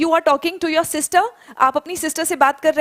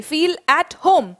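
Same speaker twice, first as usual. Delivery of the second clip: slow model answer; 2 semitones higher, much slower than usual. Shown as a demonstration. Teacher giving students the exact phrase, very slowly. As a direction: feel at home spoken very slowly and clearly. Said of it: "that's it".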